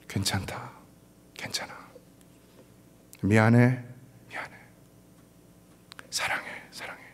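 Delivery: tender comfort. Retold or imitated. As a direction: soothe the daughter gently. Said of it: "처음으로 그런 얘기한 것 같아요". (우는 딸을 안고 부드럽게 달래듯)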